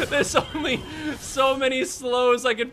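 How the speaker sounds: laughing. Real, not acted. Laughing after a lost fight.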